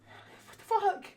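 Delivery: high, loud, drawn-out mock outburst; exasperated disbelief. Passenger reacting to the driver's ticket, exasperated disbelief.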